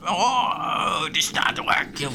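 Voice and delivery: kobold scolding. Acted.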